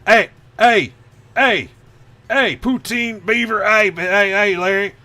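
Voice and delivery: high, loud Canadian "ey" calls; exaggerated, shouty Canadian caricature; mock Canadian. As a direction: ham up a Canadian friend hailing someone. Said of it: "I got a friend who's from Canada".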